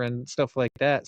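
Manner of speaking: ordinary talk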